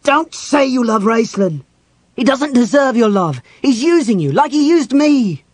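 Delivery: furious high-pitched shouting; losing control. A narrator's reading. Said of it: "Completely losing control of himself".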